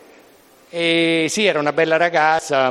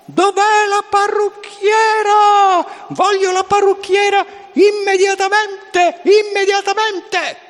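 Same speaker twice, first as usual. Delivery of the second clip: high, furious bellowing shout; wild unhinged ranting; 4 dB louder, slower than usual. Retold or imitated. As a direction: scream like a madman for the hairdresser. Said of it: "comincia a urlare come un pazzo".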